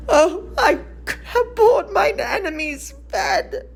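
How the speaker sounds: a wail breaking through the words; anguished cry. Acted. Mother hears her son must die.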